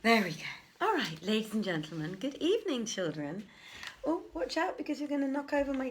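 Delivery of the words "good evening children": ordinary delivery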